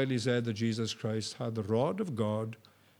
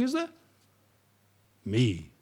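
normal talking voice

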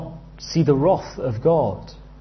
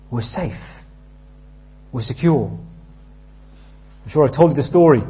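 ordinary talk, nothing marked

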